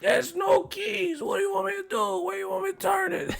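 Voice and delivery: very high, strained mock-angry voice; hammed-up high impression. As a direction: squeeze out an exasperated high protest. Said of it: "he started getting hella mad".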